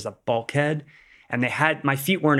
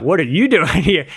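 higher, louder startled question; shocked to see his parents walk in; surprised; 5 semitones higher, 7 dB louder than usual. Retold or imitated. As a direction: blurt it out, caught off guard. Parents suddenly appear at hospital.

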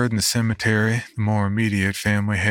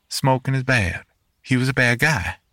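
higher, lightly voiced small boy; a child's matter-of-fact verdict, pitched up; little boy; 6 semitones higher than usual. Young boy telling his uncle Henry was bad, higher child voice.

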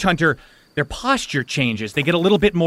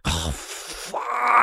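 soft, long drawn-out groan; dread; 6 semitones higher, 5 dB quieter than usual. Acted. Spirit hears the plan, groaning with dread. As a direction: groan the curse out slowly and softly.

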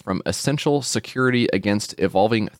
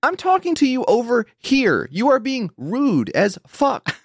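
higher, slow indignant telling-off; mock scolding; 13 semitones higher, slower than usual. Scolding the smart speaker for being rude, higher mock anger.

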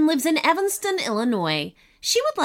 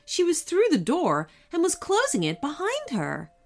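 ordinary delivery, nothing marked

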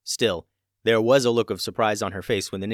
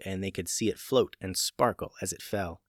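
plain reading voice

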